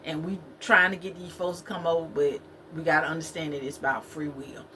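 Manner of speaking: ordinary talk, nothing marked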